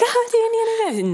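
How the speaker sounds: very high-pitched fawning waitress voice; mock an infatuated, giggling waitress; giggly waitress.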